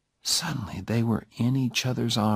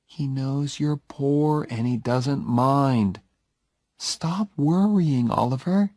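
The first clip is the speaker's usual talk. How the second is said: slow, much higher, soothing; calm him down gently and slowly; reassuring; 5 semitones higher than usual. A narrator's reading. Jenny reassures a nervous Oliver, slow and higher.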